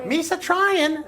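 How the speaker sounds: high, drawn-out cartoon alien voice; Jar Jar. Joking with co-hosts about the prequels, goofy alien impression. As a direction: impersonate the goofy alien, stretched and loud. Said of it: "the big problem with Jar Jar".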